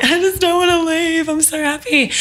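whimpering through tears, half-joking; mock sobbing. Telling her friend Tim she doesn't want to leave, high mock sob.

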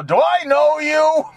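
mock snub. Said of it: "And they go".

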